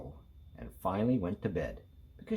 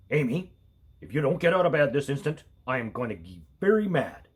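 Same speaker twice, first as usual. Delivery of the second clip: a hint of a stern dad, a touch louder; stern father voice; 3 semitones higher, 4 dB louder than usual. A narrator's reading. Father at the bedroom door, lightly stern warning. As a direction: warn her like a strict father, slightly quick. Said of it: "in his sternest father voice".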